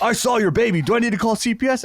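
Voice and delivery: high, pushy imitation of a cop; mock threatening cop. Little cop threatens him in retelling.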